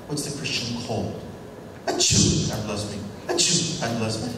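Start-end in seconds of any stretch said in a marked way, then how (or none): 1.9-2.5 fake sneeze. 3.3-3.8 fake sneeze.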